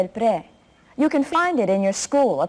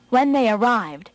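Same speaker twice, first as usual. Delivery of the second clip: usual speaking voice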